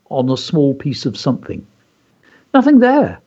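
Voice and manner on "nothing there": put-on higher, louder boy trying to sound steady; a boy hiding his fear from his parents; least wobbly voice. A narrator's reading. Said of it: "said David in his least wobbly voice".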